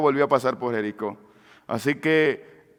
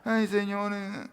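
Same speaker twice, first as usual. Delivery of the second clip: much softer, shy little plea; timid prayer; 5 semitones higher, 11 dB quieter, slower than usual. Acted. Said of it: "Tímidas, timoratas". (súplica tímida y muy suave)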